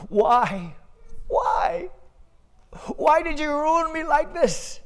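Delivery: high, despairing why; anguished cry. Acted. Farmer despairs at his ox.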